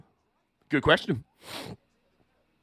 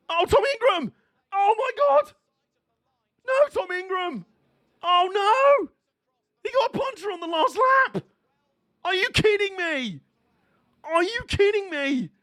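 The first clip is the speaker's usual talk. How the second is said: real dismayed shout; 12 semitones higher than usual. Real, not acted. Streamer watching a favourite driver puncture, anguished shouting. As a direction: cry out in disbelief at the crash.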